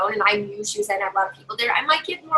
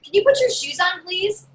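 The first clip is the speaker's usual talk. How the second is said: higher, sweet asking tone on "Can you put your shoes on, please?"; pleading ask; 7 semitones higher than usual. Shown as a demonstration. Parent politely asking kids for shoes, sweetly pleading. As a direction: ask nicely like a pushover. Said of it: "you ever ask them".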